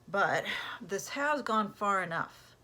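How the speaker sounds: slow, firm, resolved father; determined. Acted. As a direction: lay down the law, steady and slow. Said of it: "Mister Hollister said with determination".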